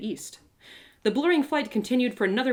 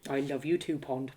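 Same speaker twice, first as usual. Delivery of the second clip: tender; 5 semitones lower than usual. Acted. Rock tender reply.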